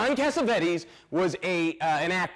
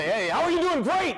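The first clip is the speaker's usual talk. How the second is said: high-pitched rousing greeting and answer; hyped-up crowd call; 11 semitones higher than usual. Retold or imitated. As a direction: act out a pumped-up call and response. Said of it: "I went nuts".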